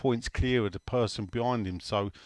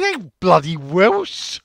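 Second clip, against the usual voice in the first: put-on slow, loud, higher Welsh accent; 6 semitones higher, 8 dB louder, much slower than usual. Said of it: "Welsh voice, indeed".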